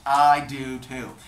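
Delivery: loud, drawn-out agreement; put-on enthusiasm. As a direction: stretch it out, playing along. God echoes her love of picnics.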